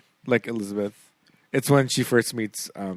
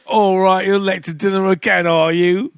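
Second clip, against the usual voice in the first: higher, louder Cockney queen voice; Cockney accent; 7 semitones higher, 6 dB louder than usual. Retold or imitated. Queen scolding a late guest in Cockney accent. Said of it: "With a Cockney accent".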